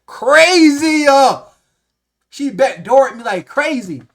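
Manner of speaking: outraged exclamation. Real, not acted. Telling viewers the manager betrayed him, loud slow outrage. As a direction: belt it out in disbelief.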